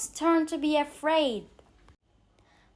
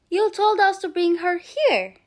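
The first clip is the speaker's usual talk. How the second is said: loud, higher pirate yell; 6 semitones higher, 7 dB louder than usual. Acted. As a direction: call back up to the captain. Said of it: "one of the pirates yelled".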